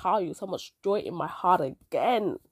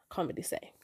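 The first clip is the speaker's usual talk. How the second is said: whispered aside; 10 dB quieter than usual. Real, not acted. Coy aside to listeners.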